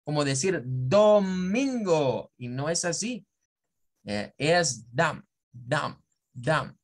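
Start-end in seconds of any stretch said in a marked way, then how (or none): 0.6-2.3 "domingo" slowly, with a round O; full Spanish O. Shown as a demonstration. Teacher exaggerates the Spanish vowel, slow and full. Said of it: "como si se tratara de una O del español". 4.9-6.8 "dam" with a weak schwa, lower pitched; softer schwa syllable. Shown as a demonstration. Teacher corrects the O to schwa, softer. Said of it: "Es más suave".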